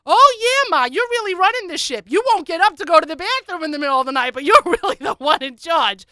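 high, loud mocking holler; sarcastic retort. Daughter answering mother's claim of control, loud sarcasm. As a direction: pile on the sarcasm at full volume.

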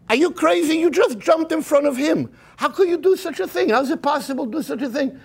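high, shocked scolding wife. Retold or imitated. The wife scolding her husband for cutting the line, shocked and high. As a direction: voice the wife's outrage, pitched high. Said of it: "the wife says to the guy".

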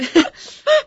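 loud, high burst of laughter; high delighted laugh. Real, not acted. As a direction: let out a gleeful squeal of a laugh. Excited plan to go out.

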